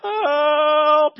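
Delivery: loud high yelp of "Help!"; a nursery worker calling out in alarm; high, loud shout; panicked cry for help. Retold or imitated. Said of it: "She's like".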